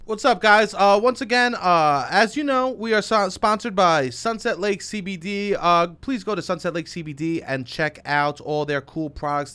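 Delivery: loud, upbeat ad read; sponsor read. Acted. Host reading the sponsor spot, loud and upbeat. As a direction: hype the sponsor to the audience.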